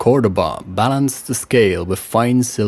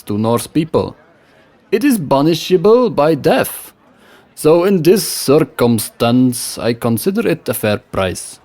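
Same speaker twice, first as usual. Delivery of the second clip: put-on smooth salesman in a West Frankish accent, pitched higher; 6 semitones higher, 4 dB louder than usual. A narrator's reading. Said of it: "with a West Frankish accent".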